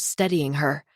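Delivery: ordinary delivery, nothing marked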